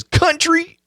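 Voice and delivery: quick high country accent on Country; one word in a put-on country accent. Shown as a demonstration. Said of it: "I do a country voice".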